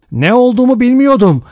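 high-pitched, loud protest; crying out. A narrator's reading. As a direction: cry out in desperate denial. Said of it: "diye haykırdı Rogers". (haykırarak, tiz sesle)

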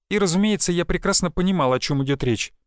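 plain reading voice

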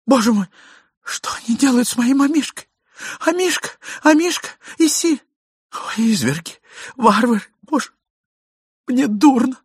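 high-pitched wail of distress; distraught lady. A narrator's reading. Lady crying for her lapdog, high distraught wailing. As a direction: lament in a high, fainting lady's voice.

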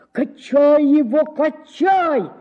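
rowdy chorus of shouts; noisy crowd shouting. A narrator's reading. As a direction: urge them on, shouting. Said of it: "зашумели голоса".

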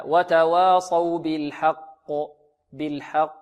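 وتواصوا بالحق ended on a held qaf with an echoing bounce; stopping on qaf. Shown as a demonstration. Teacher showing the verse-end stop, bounced qaf in بالحق. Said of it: "إذا وقفنا على كلمة حق ننطقها هكذا".

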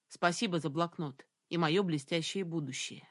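ordinary delivery

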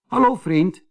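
Kees Konijn. A narrator's reading.

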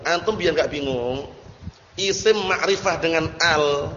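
usual speaking voice

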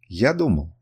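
usual speaking voice

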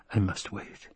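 soft, distant inner voice; drugged Duke steeling himself; inner resolve. Acted. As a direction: say it to yourself, quietly. Drugged Duke's inner thought.

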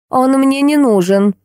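high, slow, cross retort; mildly grumpy. A narrator's reading. Woman rebuffs talk of answering machine, mildly grumpy. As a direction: dismiss it slowly and crossly. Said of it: "сердито буркнула тётка".

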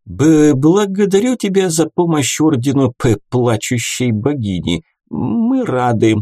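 halting stammer on "благодарю" and "плачущей". A narrator's reading. Brother Yur stammers his thanks.

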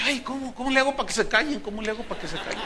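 half-laughing exasperation; exasperated rush. Retold or imitated. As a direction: rattle off the frustration. Exasperated retold thought.

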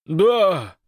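high, loud yelled assent. A narrator's reading. Большой accepts the order, yelling back. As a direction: yell back obediently. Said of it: "заорал Большой".

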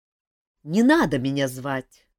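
plain reading voice

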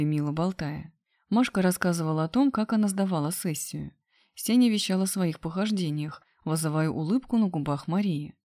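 ordinary delivery

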